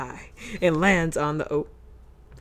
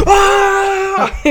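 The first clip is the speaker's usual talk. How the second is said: sudden scream; 13 semitones higher, 7 dB louder than usual. Real, not acted. Turtle game sudden scream.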